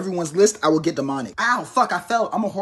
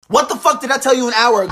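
scolding mom; 4 semitones higher, 5 dB louder than usual. Acted.

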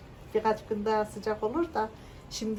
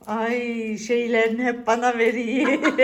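ordinary talk, nothing marked

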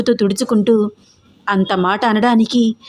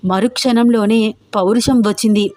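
plain reading voice

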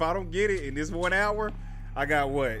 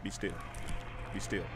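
very soft, low urging; hushed; 8 semitones lower, 11 dB quieter than usual. Real, not acted. Player hiding from the enemy, hushed. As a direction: keep it tiny and low.

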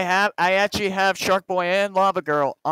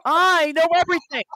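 hammed-up movie teacher scolding; stern teacher impression; 7 semitones higher than usual. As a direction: lay down the law, building at the end. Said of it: "did a better job than George did".

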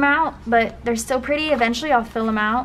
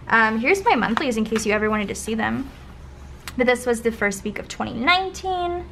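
normal talking voice

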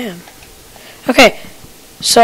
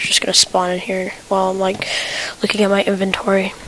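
whisper; 7 dB quieter than usual. Real, not acted. Fearing a creeper spawn.

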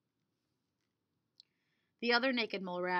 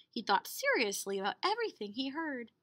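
usual speaking voice

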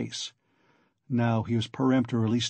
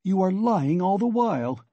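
higher-pitched outcry; crying out; 6 semitones higher than usual. A narrator's reading. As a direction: cry out an accusation. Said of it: "he cried".

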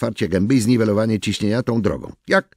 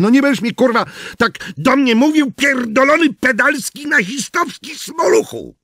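much higher, louder raging insults; furious tirade; 4 dB louder than usual. Acted. Garbage man cursing his coworker in rage. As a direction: explode at him.

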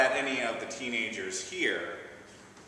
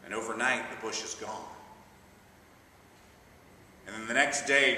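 ordinary delivery

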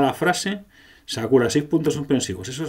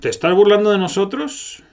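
higher, louder, offended hero; indignant hero voice; 9 semitones higher, 6 dB louder than usual. Acted. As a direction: throw the accusation back at the villain. Hero answering the villain.

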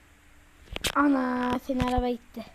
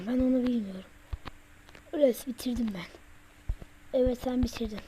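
ordinary talk, nothing marked

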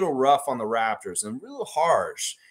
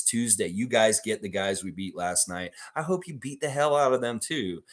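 ordinary talk

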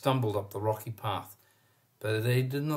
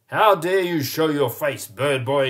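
angry shout; 3 semitones higher, 7 dB louder than usual. A narrator's reading. The mayor confronts Prosper with an angry shout. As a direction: bellow at him with contempt. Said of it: "he shouted".

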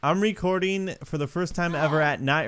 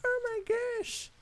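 tiny high-pitched soft squeal; mock gushing; 9 dB quieter than usual. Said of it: "I'm just like".

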